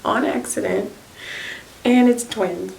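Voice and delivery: mock shock, spelling out the news; stunned disbelief. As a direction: sister repeating the news in disbelief. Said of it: "And she's like".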